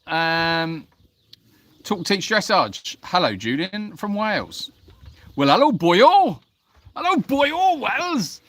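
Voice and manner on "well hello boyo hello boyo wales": loud, high, swooping put-on Welsh lilt; mock Welsh accent. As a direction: hail them in hammed-up Welsh. Mock Welsh greeting to viewer from Wales.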